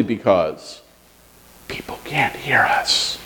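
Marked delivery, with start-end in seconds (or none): 1.7-3.2 slow playful whisper. Shown as a demonstration. Pastor joking with kids about whispering, slow whisper. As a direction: whisper it like a secret. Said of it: "we don't practice whispering".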